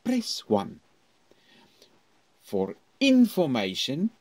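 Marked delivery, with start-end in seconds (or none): 0.0-0.8 "Press one" rolled up high; voice rolls over. Shown as a demonstration. Teacher repeating a phone-menu prompt with a roll. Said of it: "Can you hear my, my voice roll over".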